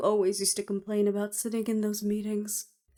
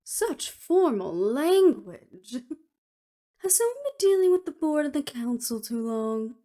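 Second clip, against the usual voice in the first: amused teasing; 5 semitones higher than usual. Acted. Harriet to an old friend talking formally, amused teasing. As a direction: tease with a laugh in the voice.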